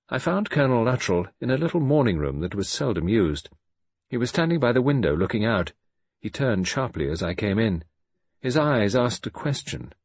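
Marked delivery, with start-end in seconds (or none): none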